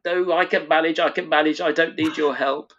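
high, loud indignant protest; Sarah insisting. Retold or imitated. Sarah refusing the Doctor's help, high indignant voice. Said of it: "and she's like".

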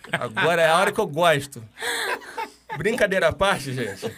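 loud laughing words. Real, not acted. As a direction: laugh through the line. Laughing after joking about pastor.

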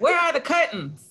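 high-pitched mock British; hammed-up British movie-quote accent. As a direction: put on a comic British accent. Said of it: "that's my British accent".

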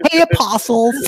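a light, casual American greeting; mock American Jesus. Imitating the American-accented Jesus greeting his apostles, casual. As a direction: toss it off like a laid-back American. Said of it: "suddenly very American".

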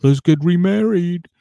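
high, loud put-on husband voice; Marcelino voice. Husband proposing to renew vows, high and loud. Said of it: "And he's like".

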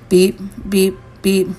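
short, evenly spaced beeps held on one pitch; imitated alarm beeps. A narrator's reading. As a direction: mimic a timer going off, beep by beep. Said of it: "some sort of timer going off".

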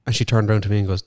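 ordinary talk

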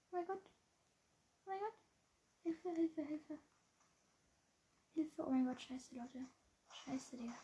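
hushed, high panicked cries for help; terrified gamer hiding from enemies; panicking gamer. Real, not acted. Enemy footsteps close by.

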